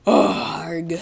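loud exasperated "arg"; frustrated groan. Real, not acted. Player annoyed at breaking the pick, loud frustrated groan. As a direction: groan loudly at your own blunder.

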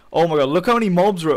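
startled gamer outburst; real alarm. Real, not acted. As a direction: blurt it out on spotting danger. Spots a crowd of enemy mobs.

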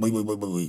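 low, soft lip burble; quick bubbling bıy-bıy sign-off noise; comic babbling. Acted. Karagöz's nonsense sign-off.

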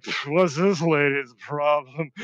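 slow, dopey mock puzzlement; mildly dismissive. Tired soldier brushing off a warning, mildly dismissive. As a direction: puff it off, slightly annoyed and oblivious. Retold oblivious reaction to warning.

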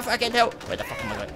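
ordinary talk

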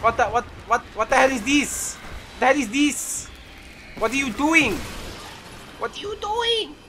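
very high squeaky voice; mock squeaky panic. Gamer squealing at teammates, high mock panic.

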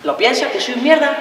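tense probing question. Acted. Boyfriend testing whether she despises him, tense and restrained. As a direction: ask it high and contained, before any shouting. Quarrel, question before it explodes.

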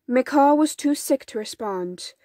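usual speaking voice

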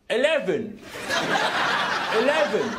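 put-on English accent; attempted English accent. Scotsman trying English on a voice-activated lift, badly faked English accent. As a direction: have a careful go at sounding English. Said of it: "Try an English accent, right?".

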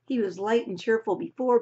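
ordinary delivery

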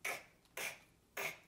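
"K" as a bare "kh" click, not "kay"; phonics K sound. Shown as a demonstration. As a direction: sound out "K, k, k" as three short consonants.

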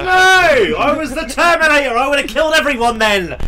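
loud, high frustrated yelling; furious shout. Real, not acted. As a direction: rage at losing the streak. Angry at dying mid-streak.

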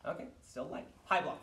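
ordinary delivery

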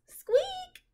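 tiny squeak way up high; high mouse squeak. Acted. Peanut the mouse answering the children, a high squeak.

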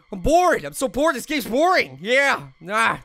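normal talking voice

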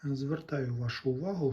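normal talking voice

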